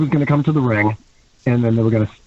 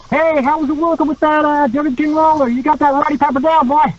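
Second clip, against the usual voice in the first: high-pitched, excited wrestler voice; put-on high, hearty greeting; Jeff Jarrett; 12 semitones higher than usual. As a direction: imitate an eager colleague, pitched high throughout. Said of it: "Jeff Jarrett went up to me".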